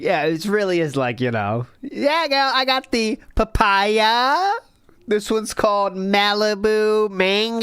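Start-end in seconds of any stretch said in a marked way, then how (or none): 1.8-7.6 put-on teen girl voice. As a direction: gush like a teen girl over drinks.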